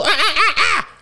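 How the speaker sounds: very high screeching monkey cries; boy mocking the girls with monkey screeches. A narrator's reading. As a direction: screech like an ape to mock them. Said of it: "he screeched like a monkey".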